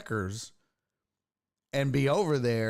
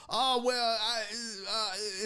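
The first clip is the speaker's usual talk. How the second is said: higher-pitched, faltering mock excuse; imitated stammering; 7 semitones higher, slower than usual. Tow driver caught out by the constable, mock stammering. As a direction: imitate someone stalling for an answer, trailing into uh. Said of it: "he just starts stammering".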